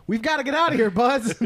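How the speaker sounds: high, urgent cartoon voice; hammed-up movie cowboy calling out to his pal; Woody. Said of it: "Woody was like".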